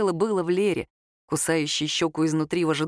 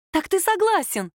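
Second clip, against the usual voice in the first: much higher, eager question; excited; 11 semitones higher than usual. A narrator's reading. Girl asks him if he agrees, eagerly excited. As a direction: ask it as if nearly jumping off the chair. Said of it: "Девушка чуть не подскочила на стуле".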